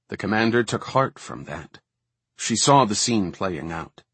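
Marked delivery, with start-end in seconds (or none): none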